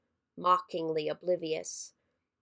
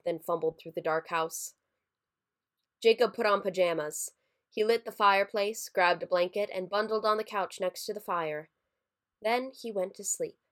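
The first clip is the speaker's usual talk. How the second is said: plain reading voice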